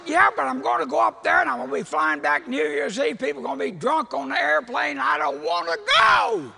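loud, high, griping rant; complaining shout. Retold or imitated. Husband griping to his wife about flying, loud complaint. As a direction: gripe at full volume to your wife. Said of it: "I said".

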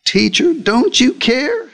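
accusing. Retold or imitated. Preacher replaying the disciples' accusation, slow and reproachful. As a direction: lean on it as a bitter accusation. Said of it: "they accused God of being indifferent".

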